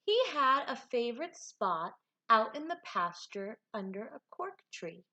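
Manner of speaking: lively, expressive storybook reading; animated read-aloud with big swoops in pitch; reading to children. A narrator's reading. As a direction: read it like a picture book for a class. Teacher reads picture book aloud.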